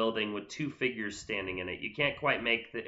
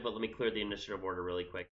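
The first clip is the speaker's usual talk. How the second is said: normal talking voice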